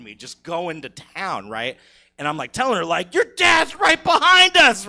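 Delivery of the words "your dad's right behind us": loud, high, urgent warning shout. Retold or imitated. Warning his girlfriend the dad is right behind them, yelling. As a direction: yell the warning as he charges in.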